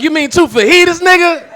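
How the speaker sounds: high, loud incredulous correction, laughing; mocking correction. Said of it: "I'm like".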